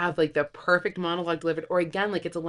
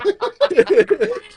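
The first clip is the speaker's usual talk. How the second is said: loud laugh; 13 semitones higher, 12 dB louder than usual. Real, not acted. Laughing at quote.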